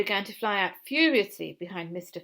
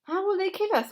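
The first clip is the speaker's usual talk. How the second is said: put-on frightened child; 12 semitones higher, 5 dB louder than usual. A narrator's reading. Frightened small fox asks.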